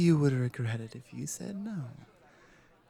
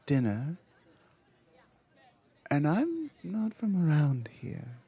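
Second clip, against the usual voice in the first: ordinary talk, nothing marked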